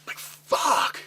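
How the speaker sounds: high, breathless cry of frustration; a choked, airless yelp of "fuck"; strangled cry. Retold or imitated. Pinned under his own shirt mid-fight, a strangled cry. Cursing as shirt pulled over face.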